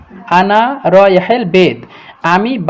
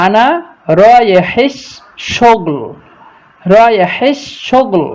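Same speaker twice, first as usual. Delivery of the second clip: slow word by word; much slower than usual. Shown as a demonstration. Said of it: "আস্তে আস্তে চেষ্টা করতেছি আপনাদের জন্য".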